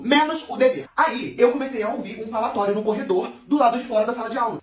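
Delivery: usual speaking voice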